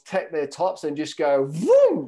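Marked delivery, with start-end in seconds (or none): 1.5-2.1 loud, high vroom sound effect; a big excited vroom. Retold or imitated. Flashing crowd, loud high vroom sound effect. Girls flash their tops.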